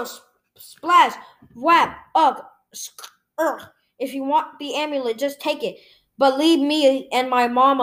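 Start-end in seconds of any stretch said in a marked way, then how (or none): none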